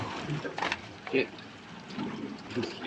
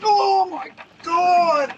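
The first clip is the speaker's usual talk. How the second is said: amazed; much slower than usual. Real, not acted. Huge shark on the sounder.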